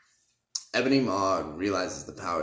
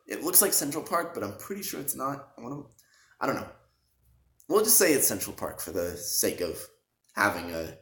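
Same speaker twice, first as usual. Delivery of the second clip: usual speaking voice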